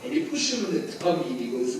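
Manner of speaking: ordinary delivery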